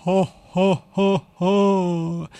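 slow, villainous ho-ho laugh; monster laugh. A narrator's reading. Monster holding captured Rosa, laughing villainously. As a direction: boom out a monster's mocking laugh. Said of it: "lachte das Monster".

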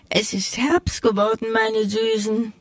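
a gentle hint of a caring mouse mother.

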